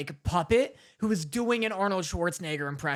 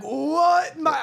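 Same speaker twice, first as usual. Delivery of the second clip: high, loud bad Austrian impression; Arnold Schwarzenegger; 7 semitones higher, 6 dB louder than usual. Arnold shocked by his daughter, bad Austrian impression. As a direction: botch an Austrian action-star voice. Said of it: "I can't do the impression".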